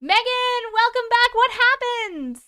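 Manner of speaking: high, loud welcoming call; excited greeting. Real, not acted. Streamer welcomes back a returning member, excited high holler. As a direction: cry it out high, stretching the end.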